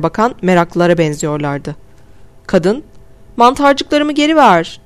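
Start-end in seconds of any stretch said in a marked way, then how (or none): 3.4-4.8 high, slow, pleading cry; shout. Acted. Woman shouting at the clerk who grabbed her mushrooms. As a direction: yell to get her mushrooms back. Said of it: "diye bağırdı".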